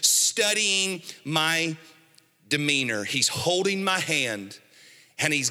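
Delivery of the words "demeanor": ordinary talk, nothing marked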